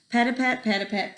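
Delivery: ordinary delivery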